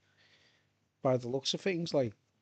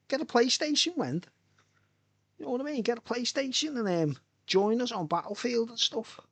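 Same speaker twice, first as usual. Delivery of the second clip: playful urging; 7 semitones higher than usual. Retold or imitated. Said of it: "urging Wendy to game".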